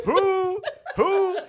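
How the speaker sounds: high squeaky laughing "who". Real, not acted. Co-host teases the rate question, high laughing squeal. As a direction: giggle it out, way up high.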